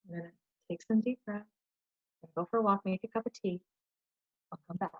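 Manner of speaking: soft, subdued retold self-talk; quiet self-talk. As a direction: talk yourself down under your breath. Retold calming self-talk after anger.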